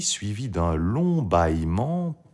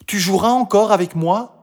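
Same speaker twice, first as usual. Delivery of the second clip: put-on high, louder girl's question; Marie; 12 semitones higher, 5 dB louder than usual. A narrator's reading. Marie asks to play again, high and hopeful. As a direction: ask hopefully in a child's voice.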